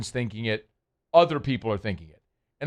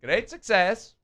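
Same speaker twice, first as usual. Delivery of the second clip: put-on higher, accented catchphrase; 7 semitones higher, slower than usual. Host joking about bowling turkey, put-on catchphrase voice. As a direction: echo it in a put-on foreign voice.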